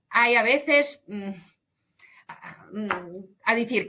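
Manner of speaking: ordinary talk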